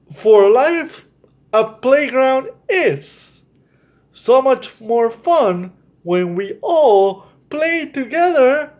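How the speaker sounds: lilting bedtime-story reading; reading to children. Acted. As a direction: read it like a picture book to little kids. Reads storybook aloud to children.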